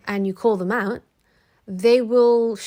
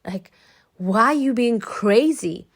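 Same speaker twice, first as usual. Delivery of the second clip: louder mock whoa, put-on voice; 3 semitones higher, 7 dB louder, slower than usual. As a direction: ham up the startled ex. Imitating ex backing off.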